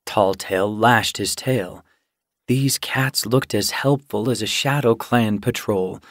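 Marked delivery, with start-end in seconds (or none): none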